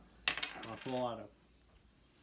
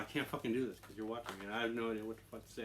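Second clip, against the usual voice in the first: usual speaking voice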